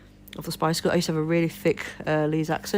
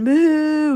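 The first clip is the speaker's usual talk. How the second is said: loud, raised, drawn-out mooing; imitated cow moo; 10 semitones higher, 7 dB louder than usual. Acting out the milk request in the shop, cow moo. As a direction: moo like a cow to get understood. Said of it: "And I was going".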